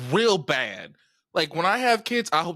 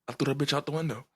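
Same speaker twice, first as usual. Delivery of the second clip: ordinary talk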